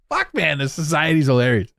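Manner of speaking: ordinary talk, nothing marked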